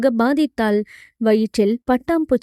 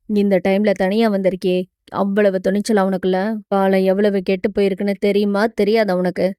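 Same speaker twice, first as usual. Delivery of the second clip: low-voiced hero scolding her at a quick pace; hero, chiding; 5 semitones lower, faster than usual. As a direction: chide her briskly in his lower voice. (கதாநாயகனின் தாழ்ந்த, வேகமாகக் கடிந்துகொள்ளும் குரல்)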